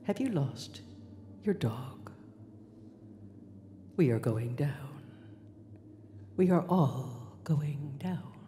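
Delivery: low, loud, halting captain's announcement; captain on intercom. Acted. Captain tells passengers of the crash, loud ominous intercom voice. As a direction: drop low and announce it with long ominous pauses. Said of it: "This is your captain".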